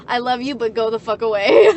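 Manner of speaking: laughing mock exasperation. Quoting herself to her parents.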